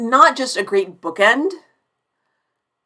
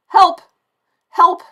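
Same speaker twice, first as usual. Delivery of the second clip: high, loud playful help help; hammed-up call for rescue to chat; mock cry for help; 9 semitones higher, 7 dB louder than usual. Can't find speakerphone, jokes to chat.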